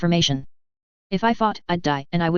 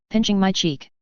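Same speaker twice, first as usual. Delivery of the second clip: plain reading voice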